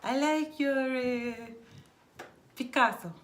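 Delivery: gushing mock compliment; imitating an Italian lady. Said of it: "she's like".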